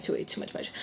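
breathy whispered trail-off. Real, not acted. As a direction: drop to a whisper at the end. Trailing self-criticism.

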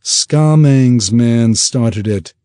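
halfling Hamel retorting to the captain, slow and firm; sharp retort. Acted. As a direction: bite each word back at him. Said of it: "Hamel retorted".

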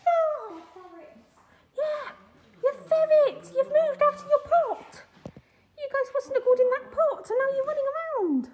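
loud, high delighted chatter; excited cooing. Real, not acted. Visitor talking to ferrets running around, excited cooing. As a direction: talk to the animals in a high, thrilled voice. Finds ferrets out of their pot.

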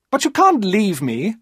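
put-on young woman refusing to be left; desperate high female cry; Maria's desperate voice.

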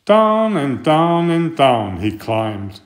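ordinary delivery, nothing marked

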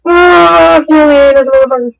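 high, loud outburst; angry cursing. Real, not acted. Player raging at an opponent mid-game, angry outburst. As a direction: curse them out at a high pitch.

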